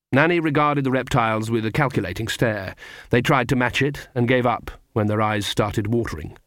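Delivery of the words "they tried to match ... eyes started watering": usual speaking voice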